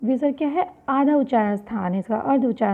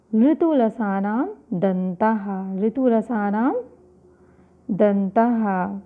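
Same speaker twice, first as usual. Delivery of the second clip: slow sutra recitation; 2 semitones lower, much slower than usual. Shown as a demonstration. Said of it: "सूत्र है".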